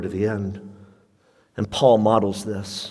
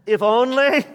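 high emphatic; 11 semitones higher than usual. Acted. Repeated quote, highest.

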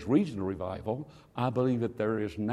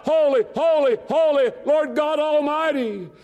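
seraphim crying out; 10 dB louder than usual. Acted. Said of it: "they cried out".

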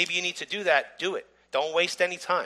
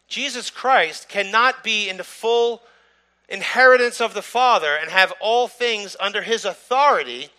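louder and higher, driving each phrase home, some slowed; fervent preaching; 6 semitones higher, 7 dB louder than usual. Acted. Teaching the congregation about being co-heirs, fervent preaching. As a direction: preach the point with full conviction.

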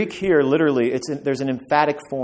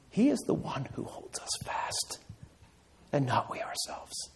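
soft breathy whisper to the congregation; 12 dB quieter than usual. Real, not acted. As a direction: drop to a whisper to draw them in. Hushed close after climax.

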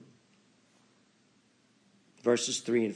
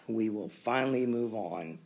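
ordinary talk

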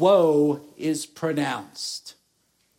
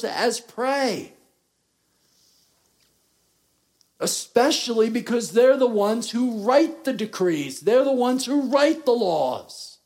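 rising preaching; 6 semitones higher, 3 dB louder than usual. Real, not acted. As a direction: pitch climbing higher and louder toward the climax. Preacher builds to climax.

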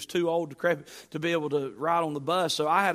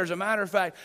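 normal talking voice

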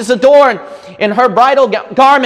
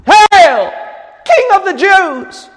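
crying it out; 9 semitones higher, slower than usual. Acted. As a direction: hail the king at full voice. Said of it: "Just want to cry it out".

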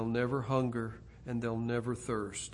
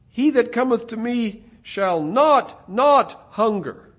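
the repeated not hit louder and higher; emphatic double not; 7 semitones higher, 10 dB louder than usual. Shown as a demonstration. Preacher showing the Greek emphasis, stressing not, not. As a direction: lean on not, not hunger. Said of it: "in a very strangely literal way".

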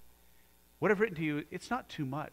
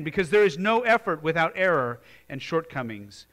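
reading Roosevelt with fire; 3 semitones higher, 8 dB louder than usual. Retold or imitated. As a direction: declaim it like a great orator. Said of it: "He says".

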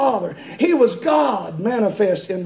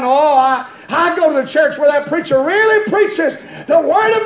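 high, loud, building sermon delivery; forceful preaching; 7 semitones higher, 7 dB louder than usual. Real, not acted. Preacher warning church members they are not saved, forceful preaching. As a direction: drive the warning into the pews.

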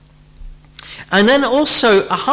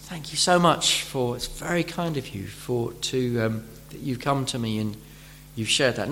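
humble gratitude; 5 semitones lower, 9 dB quieter than usual. Retold or imitated. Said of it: "if we're humble, we'll say".

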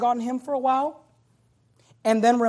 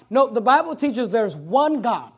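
ordinary delivery

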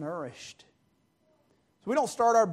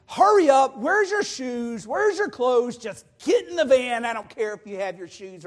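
louder, urgent rush of orders; harried parent; 3 semitones higher, 7 dB louder than usual. Acted. Parent rushing kids to church on Sunday, harried. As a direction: act out hustling the kids out the door.

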